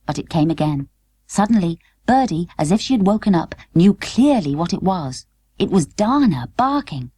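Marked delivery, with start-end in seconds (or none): none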